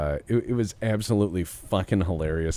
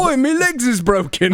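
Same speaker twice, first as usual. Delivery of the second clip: cockney chimney sweep voice; 10 dB louder than usual. Retold or imitated.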